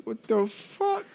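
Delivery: high, drawn-out disbelief; replaying his shock to the nurse; slow stunned. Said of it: "I look over at my nurse".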